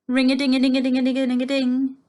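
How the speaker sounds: fast, loud, high ringing bell; a six o'clock alarm going off. A narrator's reading. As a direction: rattle it off like an alarm clock. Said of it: "the alarm would ring".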